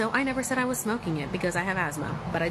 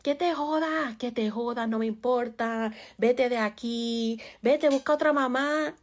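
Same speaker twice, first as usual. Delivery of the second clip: higher, loud mock scolding in Spanish; imitating angry parents in Spanish; 5 semitones higher, 3 dB louder than usual. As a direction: throw it at the kid like a fed-up parent. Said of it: "That's what they would say to me".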